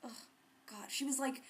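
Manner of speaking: usual speaking voice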